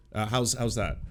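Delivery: normal talking voice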